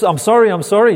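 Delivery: put-on high frightened child begging his dad; scared boy pleading. Young boy begging dad not to punish him, high and frantic. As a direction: plead like a boy about to get the belt. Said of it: "And it's just".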